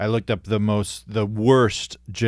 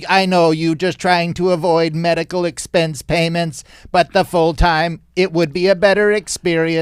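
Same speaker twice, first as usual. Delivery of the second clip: higher, louder alien complaint; alien worker voice; 6 semitones higher, 4 dB louder than usual. Pretend. Alien worker pushing his boss for full-time hours, higher and louder. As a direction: press the boss like a squeaky alien.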